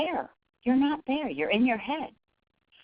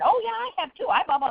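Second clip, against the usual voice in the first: much higher, eager cutting in about oneself; self-centered eager talker; 11 semitones higher, faster than usual. Retold or imitated. Fundraiser making the visit about herself, eager and pitched high. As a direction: jump in to make it about you. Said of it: "and then".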